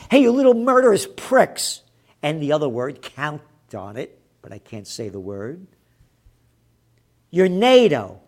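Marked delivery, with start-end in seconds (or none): 0.1-1.8 loud, higher, berating outburst; angry shout. Real, not acted. Berating officials after news item. 7.3-8.2 loud, raised-pitch challenge; angry address. Real, not acted. Addressing NATO in a rant.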